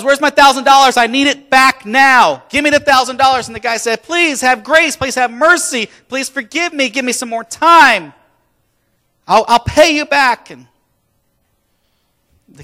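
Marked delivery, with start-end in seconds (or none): 0.0-3.5 loud, high, angry debt collecting; aggressive demand. Acted. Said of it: "he roughs that guy up". 4.0-10.5 loud, high-pitched plea for mercy; desperate begging. Acted. Debtor begging the man for mercy.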